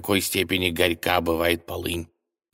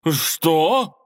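high, loud gasping what; gasped in shock; 10 semitones higher, 6 dB louder than usual. A narrator's reading. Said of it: "ахнул обманщик".

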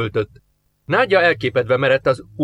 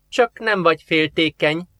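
teasing; 5 semitones higher than usual. A narrator's reading. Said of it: "támadt fel a lányban a kis ördög".

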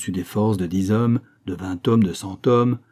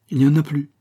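firm refusal; 6 semitones higher, 5 dB louder, faster than usual. A narrator's reading. Baker refuses old woman.

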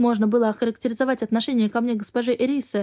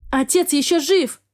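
furious cry; 5 semitones higher than usual. A narrator's reading. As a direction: shout it in fury. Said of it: "выкрикнула я, взбешённая".

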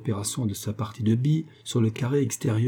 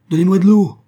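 loud, higher urgent shout; cry for water; 9 semitones higher, 12 dB louder than usual. Acted. Raymond crying for water as the woman dies, loud shout. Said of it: "s'écrie Raymond".

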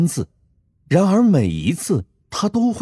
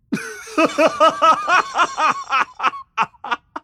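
long, high-pitched cackling laughter; belly laugh. A narrator's reading. Shoemaker seeing the trap backfire, roaring with laughter. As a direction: burst into helpless villainous laughter. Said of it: "捧腹大笑".

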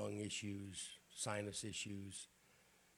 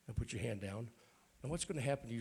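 usual speaking voice

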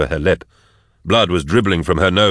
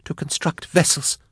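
high gasped answer; high, strained captive gasp; 10 semitones higher, 4 dB quieter than usual. A narrator's reading. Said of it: "gasped Jaff".

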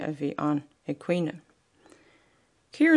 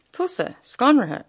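higher, amazed disbelief; astonished question, higher pitched; in wonderment; 8 semitones higher, 4 dB louder than usual. A narrator's reading. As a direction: ask it as if you can't believe it. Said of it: "agus ionú air".

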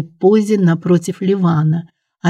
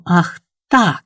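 understated fury; enraged exclamation. A narrator's reading. Prince seeing his nephew resist, furious exclamation. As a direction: exclaim it with contained rage. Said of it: "воскликнул Ливан в бешенстве".

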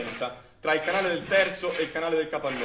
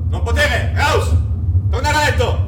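higher, harsh shouted command; barked order; 7 semitones higher, 9 dB louder than usual. Acted. Nazi soldier stops Don Ivo, barking orders. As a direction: bark him back to bed.

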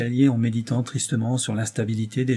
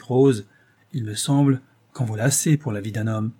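plain reading voice

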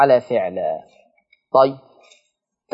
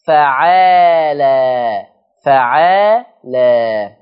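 drawn-out word; much slower than usual. Shown as a demonstration. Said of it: "لكل لفظ جاء على وزن".

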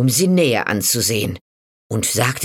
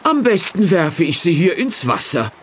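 higher, louder, hammed-up complaining; loud for show; 8 semitones higher than usual. A narrator's reading. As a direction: overplay it so the witch hears. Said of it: "Laut sagte er".

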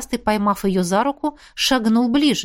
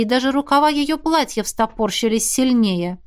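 ordinary delivery

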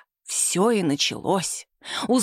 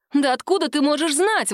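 high-pitched incredulous exclamation; exclaiming; 6 semitones higher than usual. A narrator's reading. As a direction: exclaim in disbelief, pitch jumping up. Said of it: "воскликнула Сима".

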